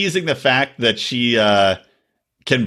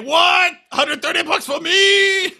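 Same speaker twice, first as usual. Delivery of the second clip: very high, drawn-out squawk of disbelief; hammed-up shock at a bill; mock outrage; slower than usual. Acted outraged patient getting a bill.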